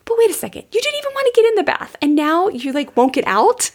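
high-pitched, incredulous protest; mock parental disbelief. Parent to kid who won't leave the bath, mock incredulous. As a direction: squeak out baffled parental exasperation, lightly comic.

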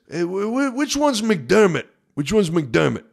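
high, eager fan voice asking around; fan's question. Retold or imitated. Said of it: "he says".

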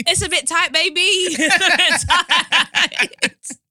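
high, laughing exclamation; words bursting through laughter; cracking up. Real, not acted. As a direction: laugh helplessly while repeating it, drawn out. Cracking up at the dilemma.

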